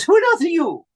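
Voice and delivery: high, shrill-free startled posh lady voice. Retold or imitated. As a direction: pitch way up for the startled hostess. Joan Sims startled by scarecrow.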